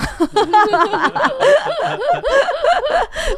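very high burst of real laughter; loud laugh. Real, not acted. Laughing after retelling her scolding, high loud laughter. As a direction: crack up laughing.

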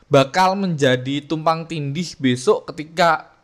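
ordinary delivery

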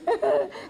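a short, soft laugh that falls away; amused little laugh; light laugh. Real, not acted. Laughs after hearing child's prayer.

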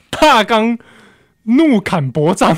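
slow, loud opera declaiming; opera announcement. Acted. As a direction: declaim it like a Peking opera title. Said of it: "我们刚才演大纲的戏".

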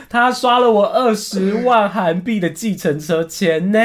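slow, high, drawn-out complaint; incredulous outrage. Real, not acted. Storyteller complains of huge taxi fare, drawn-out outrage. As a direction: stretch it out in disbelief.

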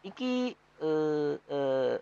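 mock Javanese "Iki ... madang", first word high; light Javanese imitation; Javanese accent. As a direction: try on a Javanese phrase, a bit unsure. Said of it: "bahasa Jawa kan cukup kayak".

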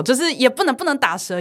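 normal talking voice

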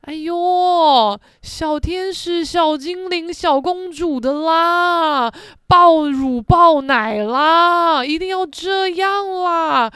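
sing-song scorn for the angels and princesses; hammed-up imitation of cute girls; mocking girly voice. Mocking other girls' cute costumes.

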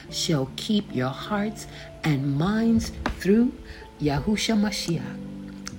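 ordinary delivery

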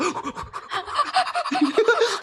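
shrieking high laugh; high burst of laughter. Real, not acted. Smoke alarm panic laugh.

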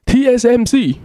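a high, excited Korean backpacker shout; imitating a Korean tourist. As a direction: squeal it like an eager Korean tourist. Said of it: "然后他就会跟你说".

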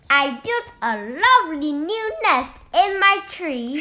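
high, loud, indignant bird voice; shrieking cross bird. A narrator's reading. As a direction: scold like an angry little bird. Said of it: "shrieked the bird".

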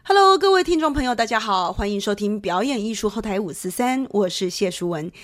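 bright radio host welcome; public radio host. Acted. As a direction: open the show like a DJ. Host opens the radio show.

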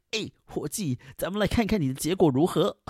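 high, chummy joking translation; lightly voiced matey officer in Chinese; buddy-buddy tone. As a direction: pal around like a friendly guard. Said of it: "以一种就是巴蒂巴蒂的方式".

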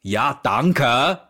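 harsh thanks. Retold or imitated. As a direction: spit out the thanks to make a point. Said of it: "我就很狠的跟他讲一声".

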